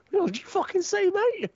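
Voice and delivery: high-pitched indignant outburst; fucked off. Acted. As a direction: act out an offended challenge, pitch leaping up. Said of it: "I'd be fucked off".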